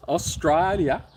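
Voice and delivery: toned-down Australian echo, falling at the end; milder mock Australian accent. Host echoes the viewer's country, milder mock Australian accent. Second, softer take of country name.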